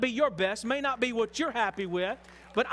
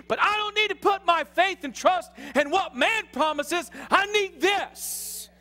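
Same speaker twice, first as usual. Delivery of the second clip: forceful, raised preacher's voice; preaching at full force; 7 semitones higher, 5 dB louder than usual. Real, not acted. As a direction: drive each phrase home to the congregation.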